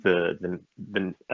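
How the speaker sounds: stumbling repeatedly on "the"; real stuttering. Real, not acted. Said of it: "I'm stuttering".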